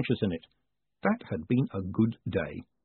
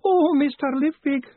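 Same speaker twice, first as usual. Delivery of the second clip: wailing; 6 dB louder than usual. Acted. Tearful woman clinging to startled Moist, high loud wail. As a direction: wail it at the top of the range. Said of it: "she wailed".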